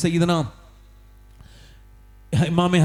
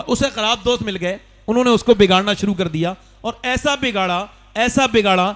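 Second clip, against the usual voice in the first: raised, pressing sermon delivery, higher and louder; fiery preaching; 4 semitones higher, 4 dB louder than usual. Acted. Preacher warning the faithful about bad friends, fiery preaching. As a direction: preach it hard at the crowd.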